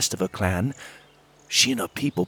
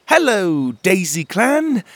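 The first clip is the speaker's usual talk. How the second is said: high, louder, slow friendly greeting; friendliest voice; 9 semitones higher, 6 dB louder, slower than usual. A narrator's reading. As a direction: greet the hidden fairies as kindly as you can. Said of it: "in the friendliest voice I could summon".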